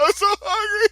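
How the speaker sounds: high, loud fake sob of a hungry kid; mock crying kid. Mimicking his crying little brother in the theater, high mock wail. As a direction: wail it like a little boy about to cry. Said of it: "And he just starts crying".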